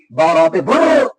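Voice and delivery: बुर्र with the र held and rolled hard, slowly; overstressed shadda. Shown as a demonstration. Teacher overdoing the doubled र so the child learns its force. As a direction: exaggerate the doubled र in बुर्र on purpose. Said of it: "ऐसे उसको तेज करके".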